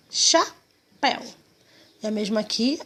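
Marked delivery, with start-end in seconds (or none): 0.1-1.2 "Chapéu" slow, cha, pause, péu; high, split "Chapéu"; syllables separated. Shown as a demonstration. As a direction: sound out "Chapéu" in two beats. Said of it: "A gente vai separar a palavrinha assim".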